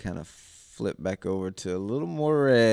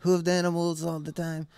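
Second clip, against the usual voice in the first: high mocking voice of the troupe; comedic foreign voice; 9 semitones higher, 5 dB louder than usual. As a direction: quote the troupe in a high put-on voice. Acting out the troupe's chatter.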